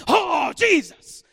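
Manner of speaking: slow, high, loud happy cry; happy shout. Retold or imitated. Southern Baptists running on the stage, happy shout. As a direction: belt it out like a joyful churchgoer. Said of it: "they're getting all happy and they say".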